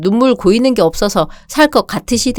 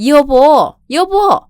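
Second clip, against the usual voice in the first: a much higher, pleading call of a husband's name; 5 semitones higher, much slower than usual. Acted. Wife calling after her husband as he leaves, pleading high call. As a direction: call him back desperately.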